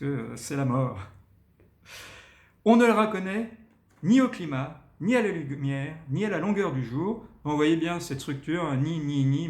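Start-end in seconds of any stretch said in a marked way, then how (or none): 2.6-7.3 high, loud "ni... ni... ni" with pauses; accents and pauses. Shown as a demonstration. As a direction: hammer each "ni" with a pause. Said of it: "il faut mettre des accents et des pauses".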